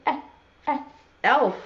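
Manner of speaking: two separate short "eh"s before "elf"; letter E sound. Shown as a demonstration. Teacher hinting at Santa's helper, sounding out "eh, eh, elf". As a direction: sound out the first vowel, pause, then say "elf". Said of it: "makes sort of a funny sound".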